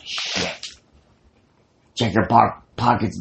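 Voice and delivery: ordinary talk, nothing marked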